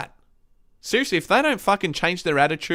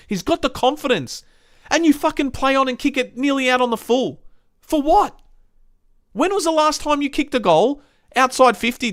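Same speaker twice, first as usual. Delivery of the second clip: real exasperation, pitched up; exasperated rant; 6 semitones higher than usual. Real, not acted. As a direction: rant higher at a teammate who let you down. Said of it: "That fucking did my head in".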